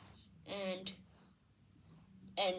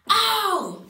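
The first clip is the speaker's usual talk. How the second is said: yelp of pain; 11 semitones higher, 11 dB louder than usual. Acted. Toy cries out in pain.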